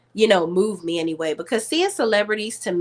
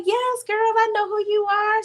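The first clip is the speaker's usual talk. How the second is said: excited recognition; 13 semitones higher than usual. Retold or imitated. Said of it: "she was like".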